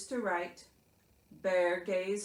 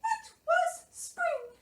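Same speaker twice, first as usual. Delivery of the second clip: very high, slow mouse voice; Mouse reading aloud; 3 dB louder, slower than usual. A narrator's reading. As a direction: read a storybook as a tiny mouse. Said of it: "Mouse read".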